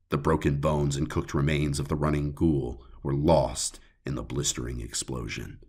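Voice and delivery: ordinary delivery, nothing marked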